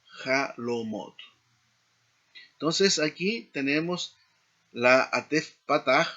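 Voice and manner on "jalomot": "jalomot" said slowly, syllable by syllable; slow demo. Shown as a demonstration. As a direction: stretch out "jalomot" for the class. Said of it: "la terminación plural es jalomot".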